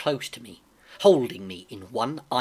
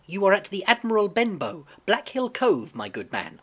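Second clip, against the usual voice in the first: lightly voiced boy, pitched up at first; boy answering; 7 semitones higher, 4 dB louder than usual. A narrator's reading. Boy tells a blind stranger where he is, lightly voiced. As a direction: answer up high, falling away at the end.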